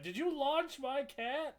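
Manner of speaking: slow, high-pitched incredulous question; mock disbelief. Imitating the owner confronting him over her launched cat, mock disbelief.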